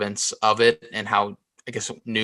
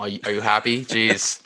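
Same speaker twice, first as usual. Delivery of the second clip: normal talking voice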